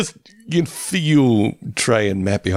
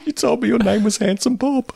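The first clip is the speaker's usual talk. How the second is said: high squeaky quote; 12 semitones higher than usual. Retold or imitated. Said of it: "quoting favourite line".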